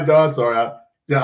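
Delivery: ordinary talk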